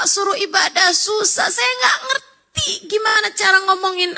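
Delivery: a mother crying out in despair. Retold or imitated. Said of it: "Dia nangis sama abah saya". (ibu yang mengadu sambil menangis)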